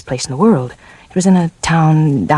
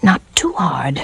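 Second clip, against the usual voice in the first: breathy whispered plea; slower than usual. Acted. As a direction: whisper it close to his ear.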